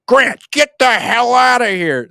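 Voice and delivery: high, loud and slow imitation of a teammate shouting; loud mock yell. Teammate tells reporter Grant to leave, imitated in a loud mock yell. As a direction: bellow it playfully at the reporter. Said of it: "he said it pretty loud".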